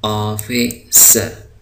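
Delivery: ऑफिसर stretched out very slowly; teacher stretching the word; very slow model word. Shown as a demonstration. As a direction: draw out ऑफिसर syllable by syllable.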